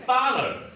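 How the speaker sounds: "father" said loud, tongue out on th; model father. Shown as a demonstration. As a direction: give "father" a clear th. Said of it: "please do it".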